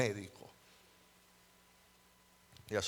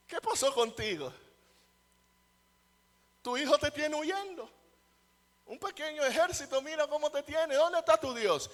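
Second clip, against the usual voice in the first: high, loud jeering at a fleeing king; voicing the mocker who taunts David, scornful and loud; mocking taunt; 13 semitones higher, 6 dB louder than usual. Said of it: "él huye y le dice".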